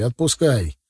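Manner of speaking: usual speaking voice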